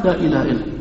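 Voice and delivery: hushed low answer; quiet reply. Shown as a demonstration. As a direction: murmur it back low. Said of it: "قلنا".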